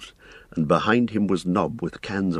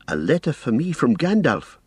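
cried out; 8 semitones higher than usual. A narrator's reading. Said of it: "cried Frodo".

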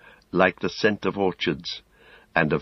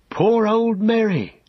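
hobbit crying out, much higher and slow; a lightly voiced hobbit calling out in pity, slow; 4 dB louder, slower than usual. A narrator's reading. Pippin rushing to his sick friend's bedside, high slow cry. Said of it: "cried Pippin".

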